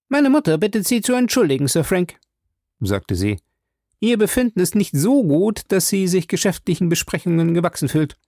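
put-on a polite but cool young lady; Betty coolly fending off the visitor. A narrator's reading. Betty's voice, raised.

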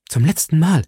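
impatient final command; final urgent call. A narrator's reading. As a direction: give her one last sharp chance. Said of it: "rief Milady".

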